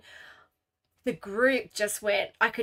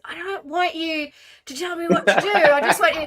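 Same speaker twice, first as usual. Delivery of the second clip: higher, louder, half-laughing plea; mock wife's protest; 7 semitones higher, 7 dB louder than usual. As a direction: play the wife pushing back, laughing. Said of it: "And she goes".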